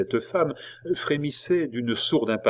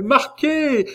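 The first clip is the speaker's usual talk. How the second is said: high, loud, ringing scream of defiance; cried out at full voice, far above normal pitch; savage cry; 6 dB louder than usual. A narrator's reading. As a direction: shriek it out like a howl. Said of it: "s'écria-t-elle".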